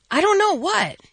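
bright, loud I don't know; playful mock puzzled. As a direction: ham up not knowing the answer. Host plays along with riddle.

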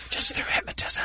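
whispered, barely voiced; hushed. Shown as a demonstration. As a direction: say it in a soft whisper. Hushed take after being teased about shouting.

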